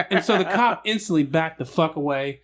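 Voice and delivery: ordinary talk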